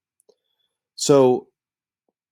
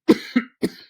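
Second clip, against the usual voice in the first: cough; 9 dB louder than usual. Real, not acted. Coughs mid-sentence.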